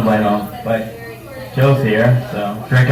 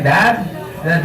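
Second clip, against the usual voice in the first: ordinary talk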